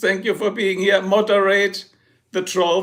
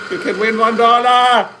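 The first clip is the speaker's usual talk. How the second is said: louder game-show call; hyped announcement; 4 semitones higher, 6 dB louder than usual. Acted. Streamer hypes the one-dollar prize to chat. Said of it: "hyping a dollar prize".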